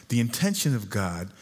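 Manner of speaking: usual speaking voice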